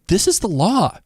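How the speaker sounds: mock unapologetic retort. They keep saying this is the law, mock sassy voice. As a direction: lift the pitch and brush them off. Voicing those who uphold the law.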